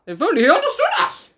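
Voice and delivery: high, climbing "If only he understood us", comically overdone; rising intonation. Acted. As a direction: let "understood us" climb right up. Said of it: "said the father with rising intonation".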